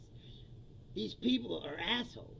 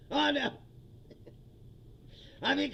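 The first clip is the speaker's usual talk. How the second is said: usual speaking voice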